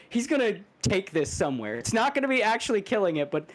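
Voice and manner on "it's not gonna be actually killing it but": usual speaking voice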